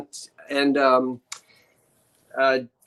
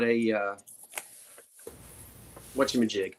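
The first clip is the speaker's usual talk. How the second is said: ordinary delivery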